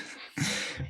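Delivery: short airy laugh; a soft breathy chuckle. Real, not acted. Laughs at the joke.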